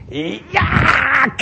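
a loud, high shout of rage; mock demon war cry; demonic yell. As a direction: throw the anger out in one cry. Demons rant anger through pigs.

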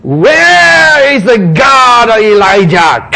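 long drawn-out high, loud cry; hammed-up prophet's shout; Elisha calling out. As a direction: shout it out slowly to the heavens. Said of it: "he says".

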